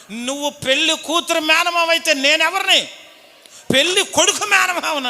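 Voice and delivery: put-on drunken uncle yelling. Bride's drunk uncle yelling at the groom's uncle. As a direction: bark it back like a drunk uncle picking a fight.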